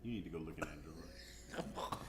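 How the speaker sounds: whispered, sneaky urging. Retold or imitated. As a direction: whisper it like a secret tip. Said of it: "I'm like".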